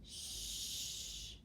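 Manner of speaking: "Sh-" as a long voiceless hush; isolated sound. Shown as a demonstration. Teacher segments shy, "Sh-" held long. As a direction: sustain "Sh-" softly with no vowel. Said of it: "Let's try".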